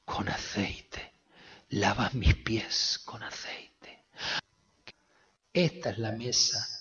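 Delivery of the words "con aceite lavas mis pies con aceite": slow, hushed whisper; hushed slow reading; whispered scripture. Acted. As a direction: breathe the words out slowly, reverently. God's words quietly read.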